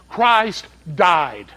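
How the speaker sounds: ordinary delivery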